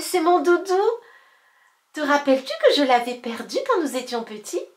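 loud, fast and eager; excited. Acted. Boy thrilled by his old doudou, excited. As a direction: rush it out happily. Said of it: "s'écria Abel".

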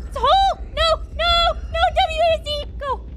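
high, loud, frantic yells; panicked shouting. Real, not acted. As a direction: yell in panic at the controls. Panics during game.

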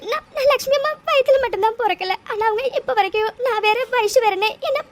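speaking through tears, voice catching; tearful confession. Acted. Orphan telling a loved one her past, crying. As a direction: let the tears come as you confess the truth. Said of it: "அழாத".

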